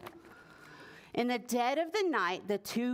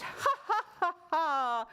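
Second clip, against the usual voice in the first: villain laugh; 5 semitones higher than usual. Acted. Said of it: "The two thieves laughed".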